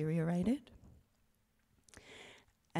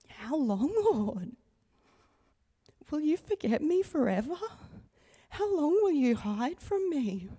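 pleading, rising and louder on the middle lines; anguished cry; 4 semitones higher, 4 dB louder than usual. Retold or imitated. Woman retelling her midnight prayer in grief, anguished plea. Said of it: "crying out to God with the words that David cried out".

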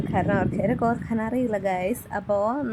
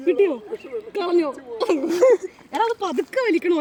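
excited; 8 semitones higher, 4 dB louder than usual. Real, not acted. Scolding the fish catch.

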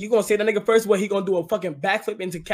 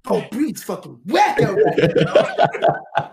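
laughing through the words; 2 semitones higher, 3 dB louder, much slower than usual. Real, not acted. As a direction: chuckle it out. Laughing while mocking Bree.

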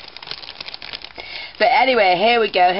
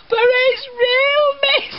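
put-on high, slow, wailing child's plea, nearly shouted; upset child's cry; much slower than usual. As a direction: mimic a tearful little boy insisting. Quoting upset crying pupil.

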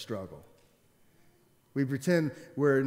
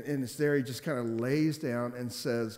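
ordinary talk

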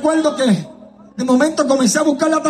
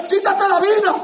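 devil's urging; 4 semitones higher than usual. Retold or imitated. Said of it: "A decirme".

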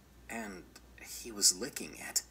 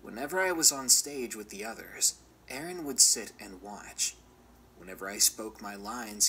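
ordinary delivery, nothing marked

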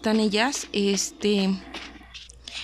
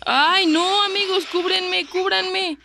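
panicked high plea for cover; high desperate call for help; cover me shout; 8 semitones higher, slower than usual. Real, not acted. Begging teammates for cover. (súplica aguda y asustada)

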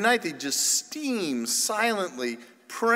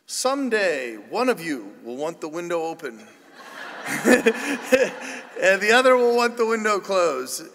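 laughing through the line; 5 dB louder than usual. Real, not acted. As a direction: break into laughter mid-sentence. Laughing through his sermon punchline.

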